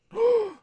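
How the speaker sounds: very high sharp gasp; startled gasp. Acted. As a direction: gasp in alarm. Startled by someone below.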